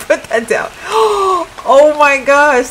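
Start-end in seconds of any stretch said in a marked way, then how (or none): 0.8-1.6 sharp gasp. Real, not acted. Sees character's poop in game. 1.7-2.7 louder, slow, stretched oh my gosh; shocked. Real, not acted. Streamer shocked at her game character, drawn-out disbelief.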